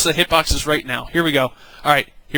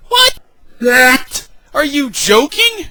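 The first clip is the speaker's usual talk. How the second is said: squeaky high disbelief, much higher; incredulous; 8 semitones higher, 3 dB louder, slower than usual. Retold or imitated. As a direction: act floored by the accusation. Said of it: "I'm like".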